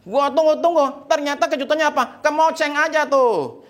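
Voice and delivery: high-pitched scornful rant; angry wife. Acted. Wife mocking husband's promised surprise, angry. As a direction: throw the word back in mock fury. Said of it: "Istrinya marah".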